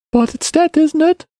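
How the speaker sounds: put-on high-pitched probing boy; insistent child. A narrator's reading. Boy presses man on the killing, high child voice. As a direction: press for an answer in a kid's voice.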